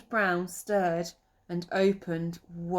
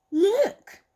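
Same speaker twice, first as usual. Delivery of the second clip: high excited cry; excited Lily; 13 semitones higher, 8 dB louder than usual. Acted. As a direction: squeal it out as the girl rabbit. Lily spots something at water.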